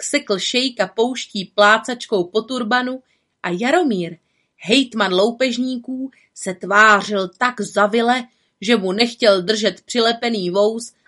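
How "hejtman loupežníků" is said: plain reading voice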